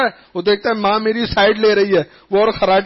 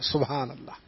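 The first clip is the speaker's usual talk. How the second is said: choked up; 7 semitones lower, 12 dB quieter than usual. Real, not acted. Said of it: "emotional exclamation".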